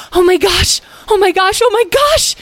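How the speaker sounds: very high, loud squeals; overexcited high character voice; wildly excited. A narrator's reading. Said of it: "flying into the air in excitement".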